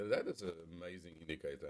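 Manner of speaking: faint, breaking up over a bad mic; words dropping out, far from the microphone; bad connection. Real, not acted. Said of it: "Your voice broke up there".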